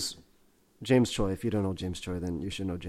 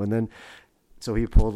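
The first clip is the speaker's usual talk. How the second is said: ordinary talk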